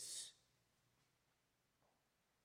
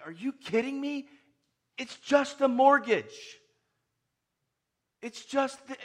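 higher and loud, scoffing at a mortgage; exasperated disbelief; 11 semitones higher, 12 dB louder than usual. Retold or imitated. Preacher mocking our worries, loud and incredulous. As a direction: shrug off a small worry in mock outrage. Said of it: "we can say".

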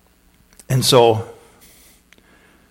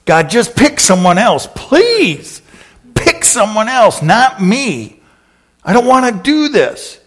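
very high, louder whiny begging; desperate plea; 9 semitones higher, 5 dB louder than usual. Acted. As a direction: wail it like a desperate child. Moses pleading desperately.

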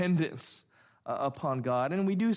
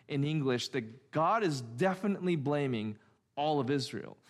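ordinary talk

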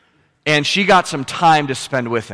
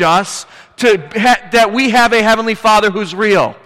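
raised, louder preacher's voice driving each point home; full-force preaching; 8 semitones higher, 5 dB louder than usual. Acted. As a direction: preach it hard to the congregation.

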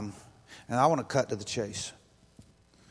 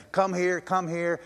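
mock yelling; 6 semitones higher, 3 dB louder, slower than usual. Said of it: "everyone's yelling".

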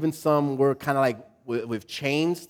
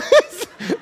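high laugh; 5 dB louder than usual. Real, not acted. Pastor cracking up at his congregation mid-sermon.

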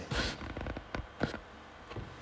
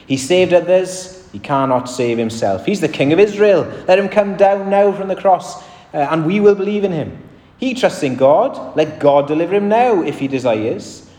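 a hint of jeering priests, somewhat higher and louder; mocking taunt; 6 semitones higher, 4 dB louder than usual. Preacher voicing the chief priests mocking Jesus, mild jeer. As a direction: read the mockery with a little sneer. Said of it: "the elders mocked him, saying".

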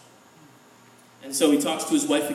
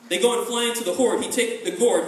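ordinary delivery

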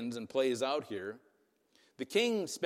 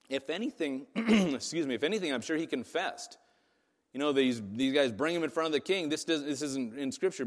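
usual speaking voice

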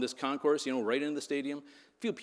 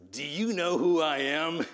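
slow, raised, self-important sergeant voice; Joseph R. Taylor; 4 semitones higher, 4 dB louder, slower than usual. Acted. Preacher mimics bandmate challenging the guard, pompous indignant voice. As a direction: pull rank with cartoonish indignation. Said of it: "And Joe says".

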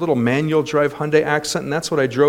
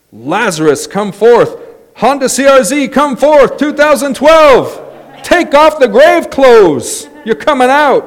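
mock resurrection command; 9 semitones higher, 7 dB louder than usual. Pastor commanding his wrecked Honda to rise, loud and high. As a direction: call the car back from the dead like Lazarus.

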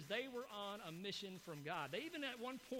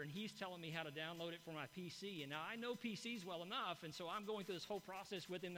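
ordinary talk

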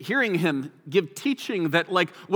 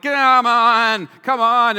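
loud, higher exasperated brush-off; frustrated scoff; 5 semitones higher, 8 dB louder, much slower than usual. Retold or imitated. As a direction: write someone off with acted impatience. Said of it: "so frustrated".

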